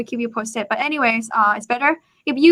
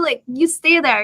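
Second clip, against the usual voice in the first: normal talking voice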